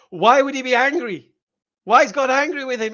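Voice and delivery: ordinary delivery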